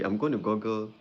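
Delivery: normal talking voice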